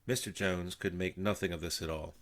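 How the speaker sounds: usual speaking voice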